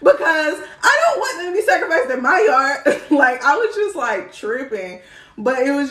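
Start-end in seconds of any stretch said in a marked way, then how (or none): 0.0-2.1 worried question. Retold or imitated. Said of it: "I'm like".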